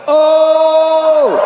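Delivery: high, loud gleeful yell; excited shout. Retold or imitated. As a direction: yell in delight at the hit. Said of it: "I was like".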